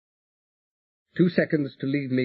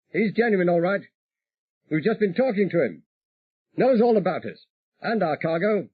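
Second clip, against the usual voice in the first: higher, breezy pilot voice; voiced pilot Peter, reassuring; 9 semitones higher than usual. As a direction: wave away the worry lightly. Peter reassuring over radio.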